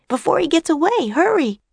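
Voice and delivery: put-on high-pitched urgent girl voice. A narrator's reading. Girl urging her companion to chase the sorcerer, high urgency. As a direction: press them to hurry, pitched well up.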